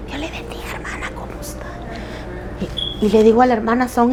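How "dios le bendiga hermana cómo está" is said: whispered gentle greeting, barely voiced; soft whisper. Preacher imitating soft-spoken sisters, whispered. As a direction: hush it like a timid sister. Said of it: "hay hermanas que".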